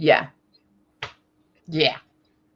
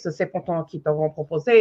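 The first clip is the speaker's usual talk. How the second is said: French lilt with a bit of drag; 3 semitones lower, 4 dB quieter than usual. Shown as a demonstration. Demonstrating the lilt of French to friends, French accent. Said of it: "when you do the French accent".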